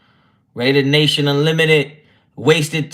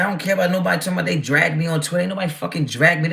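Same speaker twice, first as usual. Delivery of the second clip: usual speaking voice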